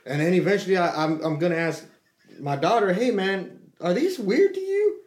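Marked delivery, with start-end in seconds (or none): 2.9-5.0 high, drawn-out puzzled question. Retold or imitated. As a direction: ask it genuinely baffled. Asks daughter about chips.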